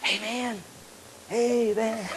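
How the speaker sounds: soft, laid-back alarm clock; pretty alarm voice. Acted. Imagined kind alarm clock waking a sleeper, mellow. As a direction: nudge gently and quietly. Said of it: "alarms that are pretty".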